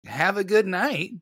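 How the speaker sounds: high, slow, loud sign-off; pointed farewell. Retold or imitated. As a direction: draw out a cheeky retold goodbye. Calm friend's cheeky parting bonus.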